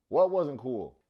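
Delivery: what wasn't cool, thrown out loud; exasperated loud question at the screen; loud baffled outburst; loud incredulous. Real, not acted.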